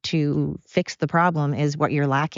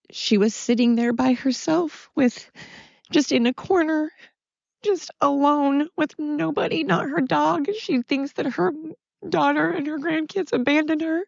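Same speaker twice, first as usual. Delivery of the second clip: real tearful voice, much higher and halting; crying; 9 semitones higher than usual. Real, not acted. Daughter grieving her mom left alone, crying. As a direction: break into tears, pitch climbing, words coming slowly with long pauses. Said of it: "I'm so sorry that I'm crying".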